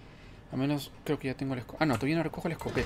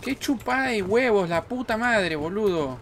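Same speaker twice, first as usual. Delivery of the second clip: loud exasperated cursing; frustrated yelling; 7 semitones higher, 6 dB louder than usual. Real, not acted. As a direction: shout the swear in frustration. Frustrated at failing puzzle.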